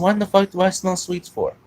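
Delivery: high-pitched imitated demand; angry suspicious dad. Dad smelling sweets on the dog and questioning his son, angry. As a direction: interrogate like an incensed dad. Acts out angry dad's interrogation.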